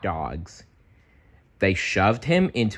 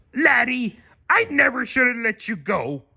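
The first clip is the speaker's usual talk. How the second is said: high pleading crab voice; Mister Krabs. A narrator's reading. Mister Krabs begging SpongeBob to come back, pleading. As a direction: plead like the gruff old crab boss. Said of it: "cried Mister Krabs".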